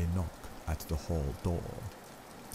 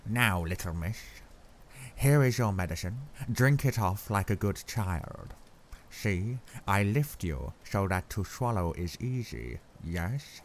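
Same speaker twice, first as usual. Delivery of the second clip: put-on cheerful accented doctor coaxing a patient; cheery Van Helsing; 3 semitones higher than usual. A narrator's reading. Doctor cheerily giving weak Lucy her medicine, accented and bright. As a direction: coax her kindly to drink, foreign lilt. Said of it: "said cheerily".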